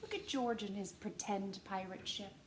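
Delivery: ordinary delivery, nothing marked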